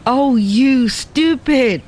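high, slow exasperated cry; exasperated exclamation. A narrator's reading. Ada exclaims at Lin Fo, high and slow. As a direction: drag out the scolding in disbelief. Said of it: "exclaimed the girl".

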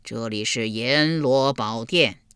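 low, slow, sinister ghost voice; eerie ghost voice. Acted. Ghost soldiers answer Yulian, slow and eerie. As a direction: drag it out like a ghoul. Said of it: "阴森森地说道".

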